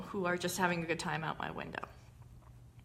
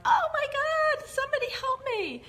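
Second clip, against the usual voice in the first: helpless victim shriek; 4 dB louder than usual. Retold or imitated. Speaker shows the child state, very high mock wail for help. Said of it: "helpless victim in the child state".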